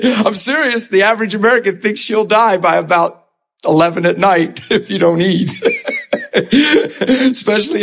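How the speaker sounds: can barely finish the joke for laughing; real laughter while talking; laughing through it. Real, not acted. Laughing at his own joke.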